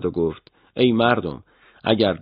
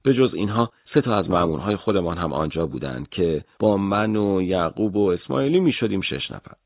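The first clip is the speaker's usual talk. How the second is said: ordinary delivery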